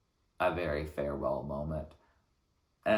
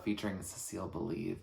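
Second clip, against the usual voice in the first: ordinary talk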